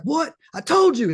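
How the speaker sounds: high-pitched worked-up outburst; imitated going nuts. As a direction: imitate a guy losing it, vindicated. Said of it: "he just goes nuts".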